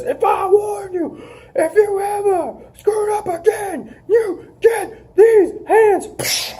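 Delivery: high mock monster yell, slow; hammed-up threat, stretched out; Braun Strowman threat. Wrestler quoting Braun's threat to Dolph, mock yell.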